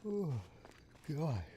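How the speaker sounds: talking through a yawn; words stretched by a yawn. Real, not acted. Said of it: "I can't stop yawning".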